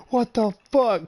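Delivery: high, loud stunned shout; imitating a friend who is losing it; freaked out. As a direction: yell it like you can't believe the shot. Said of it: "he freaked the fuck out".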